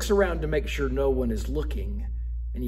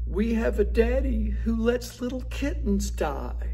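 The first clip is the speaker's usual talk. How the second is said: put-on high accusing kid voice; guilt-trip Daddy like a little kid; child's voice; 9 semitones higher than usual. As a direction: kids accusing their father from the back seat, high child voice. Kids accusing father, said crying.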